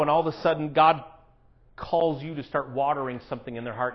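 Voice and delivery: usual speaking voice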